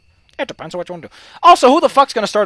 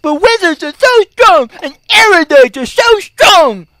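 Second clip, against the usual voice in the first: loud, high, drawn-out mock complaint; mocking whine; 8 semitones higher, 6 dB louder, slower than usual. Mimicking people who complain about wizards, loud mocking whine.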